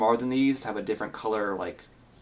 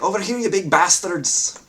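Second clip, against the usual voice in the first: taunting shout; 9 semitones higher, 6 dB louder than usual. Acted. Captain taunting giants.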